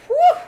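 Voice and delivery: very high, loud gasp; gasping whew. A narrator's reading. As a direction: gasp as the liquor burns. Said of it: "he gasped".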